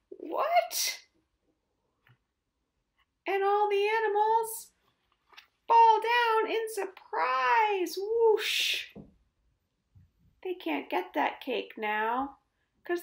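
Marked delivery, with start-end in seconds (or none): 0.1-1.0 very high startled what; shocked what. Acted. Animals shocked cake taken. 5.7-8.9 slow, higher, louder; slow dramatic fall. A narrator's reading. Dramatic falling.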